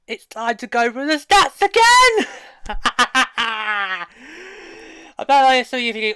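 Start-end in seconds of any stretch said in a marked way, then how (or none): 0.1-2.3 high, drawn-out showman's build; excited segment announcement. Real, not acted. Host announces returning stats segment. 2.3-5.1 long laugh rising at the end; drawn-out laugh. Real, not acted. Host laughs after own announcement.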